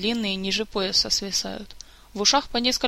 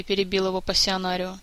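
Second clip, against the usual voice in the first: ordinary delivery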